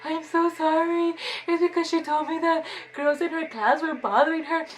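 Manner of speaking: weepy begging to the dean, acted to get off easy; fake crying plea. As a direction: sob it out to sound believable. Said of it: "I was just crying, like, trying to make it, like, believable".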